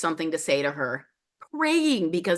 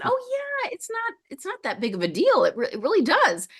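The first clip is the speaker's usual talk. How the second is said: much higher, airy and offhand, as if it's nothing; breezy shaman; 12 semitones higher than usual. Retold or imitated. Shaman casually explaining to a confused client, high breezy lilt. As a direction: toss it off like the most ordinary thing.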